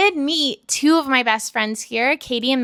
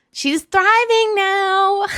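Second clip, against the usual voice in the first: high, drawn-out emotional cry; overcome, voice soaring through tears; slow, high wail of relief; tearful joy; 9 semitones higher, 4 dB louder, much slower than usual. Real, not acted. Tearful about her younger self.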